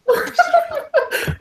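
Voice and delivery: short, high-pitched giggle; high laughter. Real, not acted. Game master amused by own waddle bit, high laughter.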